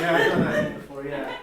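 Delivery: laughing. Pretend. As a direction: giggle through it fast. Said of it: "anyone here ever do that thing".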